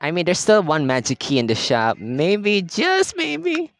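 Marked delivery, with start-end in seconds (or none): 2.0-3.7 high, dreamy "just maybe"; hopeful. Real, not acted. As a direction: float it up hopefully.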